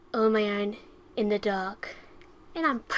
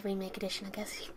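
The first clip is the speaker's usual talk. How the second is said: whisper; 3 semitones lower, 9 dB quieter than usual. Real, not acted. Quiet aside while gaming.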